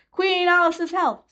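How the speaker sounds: high, slow screamed toast; top-of-the-lungs royal toast; scream at the top of her voice. A narrator's reading. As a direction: shout the toast out to the whole hall. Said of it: "screamed at the top of her voice".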